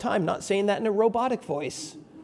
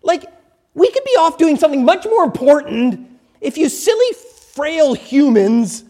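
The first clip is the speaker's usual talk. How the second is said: exasperated angels, emphatic and put-upon; oozing with attitude; 5 semitones higher, 4 dB louder than usual. Acted. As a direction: scold the humans with mock superiority. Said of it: "they're just oozing with attitude".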